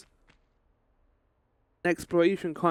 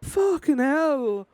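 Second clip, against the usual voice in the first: startled exclaim; 9 semitones higher, much slower than usual. Real, not acted. Still rattled after scare.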